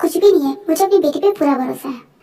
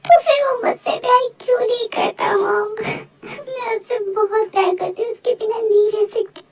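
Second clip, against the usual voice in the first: higher, tearful wail of heartbreak; acted crying; 5 semitones higher than usual. Daughter asks her mother why he cannot love her, sobbing. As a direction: wail it through acted sobs. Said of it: "पहले तुम रोना बंद करो".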